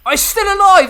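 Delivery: high, loud disbelieving shout; outraged yell. Real, not acted. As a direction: scream it at the screen. Outraged at an enemy surviving.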